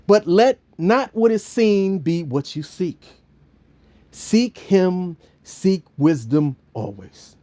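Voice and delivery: slow, solemn preaching; sermon exhortation. Acted. Preacher exhorting the listeners, slow and solemn. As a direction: urge them gently to seek him.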